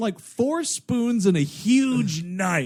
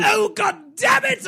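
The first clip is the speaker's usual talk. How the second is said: sudden high yelp of annoyance; frustrated shout; 4 dB louder than usual. Real, not acted. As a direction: blurt it out, exasperated. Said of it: "break my scary voice".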